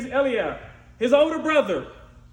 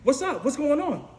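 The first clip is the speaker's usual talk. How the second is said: high, loud, easygoing youth calling out; breezy greeting from the new kid; casual young David; 7 semitones higher, 6 dB louder than usual. Retold or imitated. David arriving at the camp, loud casual greeting. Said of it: "David's like".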